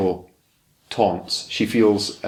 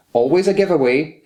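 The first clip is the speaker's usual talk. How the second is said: high, louder knowing jab from a read-aloud auntie; lightly voiced old aunt passing judgement; a hint of a prying old hag; 6 semitones higher, 4 dB louder than usual. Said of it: "the old hag said".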